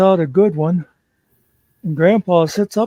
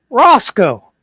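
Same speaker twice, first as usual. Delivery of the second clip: sharp loud bark of the dog's name, higher; grandpa yelling; 6 semitones higher, 6 dB louder than usual. Retold or imitated. Grandpa wakes and yells at the dog, loud. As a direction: snap awake and yell at the dog.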